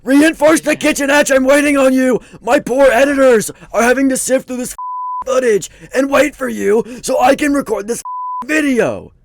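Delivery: loud, impatient shouting at a teammate; frustrated yelling. Real, not acted. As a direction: yell it, fed up with waiting.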